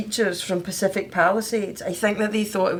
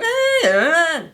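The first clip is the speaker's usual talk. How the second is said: Glaswegian grunt; 9 semitones higher, 6 dB louder, slower than usual. Retold or imitated. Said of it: "You're like".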